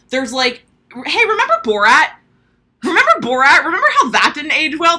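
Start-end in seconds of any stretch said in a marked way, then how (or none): 1.0-2.1 excited. Real, not acted. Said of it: "repeated rhetorical question". 2.8-5.0 loud, high; louder rhetorical jab; excited. Real, not acted. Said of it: "repeated rhetorical question".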